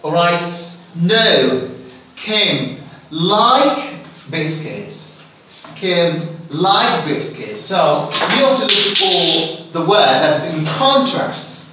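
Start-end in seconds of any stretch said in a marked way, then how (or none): 2.1-7.6 very slow, "like" stressed over the other words. Shown as a demonstration. Teacher answering the quiz question, slow with "like" stressed. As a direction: drag the line out and punch "like". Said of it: "the emphasized word here is like".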